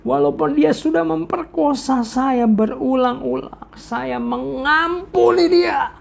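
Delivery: slow, wailing cry, pitch breaking high; a daughter crying out that she forgives her father; crying out forgiveness. Acted. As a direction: sob it out painfully, dragging every word. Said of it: "Sampai kesakitan dia ngomong gitu".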